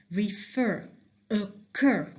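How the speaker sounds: "Refer, occur" with weight on fer and cur; stress on last syllable. Shown as a demonstration. Teacher pointing out doubled syllable, "Refer, occur" stressed at the end. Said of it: "I double the last syllable".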